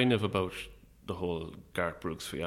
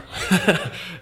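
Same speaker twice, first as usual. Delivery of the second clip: laugh; 12 semitones higher, 12 dB louder than usual. Real, not acted. Guest laughs at own joke.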